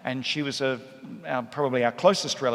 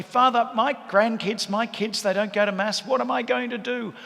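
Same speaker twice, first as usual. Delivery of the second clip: pleading, much higher voice, slowing on the first words; worried parishioner; 8 semitones higher than usual. Retold or imitated. Grandparent pleading with the priest over grandkids skipping Mass, high and worried. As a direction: play the anxious grandmother asking Father for help.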